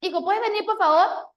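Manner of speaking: angry mother calling her son, high and loud; irritated mother's call. Retold or imitated. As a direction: call sharply, patience gone. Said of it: "y otra cosa".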